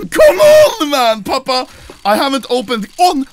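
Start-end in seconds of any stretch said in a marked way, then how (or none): 0.1-0.9 high, loud exasperated cry; frustrated shout. Real, not acted. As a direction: yell it at the bad drop. Frustrated case-opening outburst.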